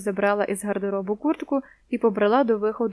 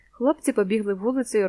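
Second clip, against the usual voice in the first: usual speaking voice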